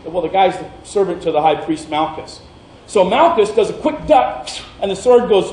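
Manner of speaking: usual speaking voice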